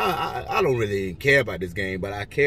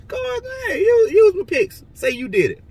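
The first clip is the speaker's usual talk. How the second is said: excited hype; 7 dB louder than usual. Real, not acted. Vlogger urging betting viewers to use his picks, high excited yell.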